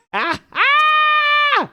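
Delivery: high shouted burst of laughter; loud laugh. Real, not acted. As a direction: cackle out loud. Laugh after a joke.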